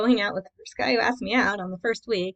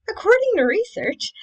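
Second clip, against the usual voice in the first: playful catchphrase; 10 semitones higher, 7 dB louder than usual. Real, not acted. Jokey catchphrase after announcing it.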